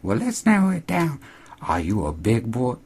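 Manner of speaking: put-on high, teasing old woman voice. Cashier teasing Noah about condoms, high and flirty. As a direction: lean in and purr it at him.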